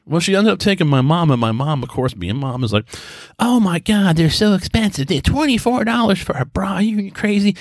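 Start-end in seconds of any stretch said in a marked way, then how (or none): 3.4-7.5 much higher, outraged mock mom; a hammed-up shocked mother complaining about prices. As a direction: play the scandalised mother at the prices. Imitating his shocked mom.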